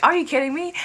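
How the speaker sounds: high, mildly indignant quote; retold annoyance. Fan retelling getting annoyed at him, mild indignation. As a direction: raise the pitch in mock annoyance. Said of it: "getting annoyed at him".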